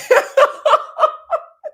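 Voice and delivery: high, loud cackle; real, high pealing laugh; burst of laughter. Real, not acted. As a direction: crack up at your own impression. Laughs after her impression.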